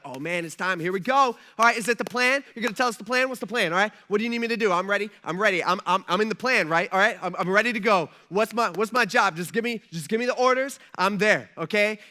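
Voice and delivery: loud, rapid eager chatter; acted giddy excitement, volume climbing; giddy angels. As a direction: tumble over yourself, raring to go. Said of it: "angels are getting all giddy".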